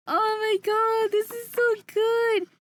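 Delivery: high, slow wail of delight; overwhelmed gushing. Real, not acted. Streamer admiring the artwork, overwhelmed gushing. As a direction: draw it out high, overcome.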